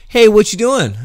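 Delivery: mock Steve calling over; coworker voice. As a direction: play the nosy coworker dropping by. Voicing coworker in anecdote.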